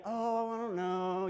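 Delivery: mock a half-hearted, unsure denial; casual shrug-off. Shown as a demonstration. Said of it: "this is not a little".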